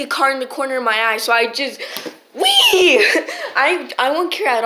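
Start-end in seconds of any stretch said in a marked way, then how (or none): 2.3-2.4 high-pitched, drawn-out "wee!"; playful squeal. Retold or imitated. Girl retelling her move in a fight, playful high squeal. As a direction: let out a silly squeal mid-story.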